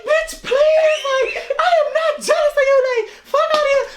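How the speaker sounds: very high, mock-outraged denial; retold indignation. Denying jealousy to friend, mock indignation. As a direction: fire back in a high pitch.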